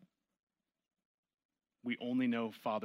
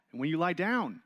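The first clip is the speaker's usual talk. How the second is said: louder, higher preaching of the line; preached emphatically; 5 semitones higher, 5 dB louder than usual. Acted. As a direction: drive the verse home from the pulpit.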